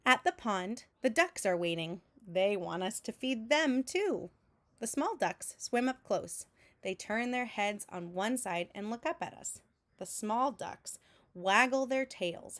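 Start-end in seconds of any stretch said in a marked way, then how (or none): none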